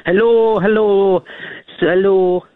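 high, loud put-on Scottish granny voice; Scottish grandmother impression. Caller doing a Scottish grandmother for the host, loud and high. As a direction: ham up an old Scottish granny greeting. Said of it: "it's a little bit racist".

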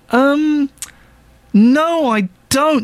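high, hammed-up reluctant no; mock awkward refusal. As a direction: pitch it up like squirming out of an invite. Refusing the swingers' party invite.

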